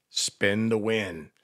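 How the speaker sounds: radio DJ plug with a punched-up swing. Pretend. Host plugging a gift card giveaway, DJ voice. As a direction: sell the prize like a radio DJ, big on key words. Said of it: "Do you like my D J voice?".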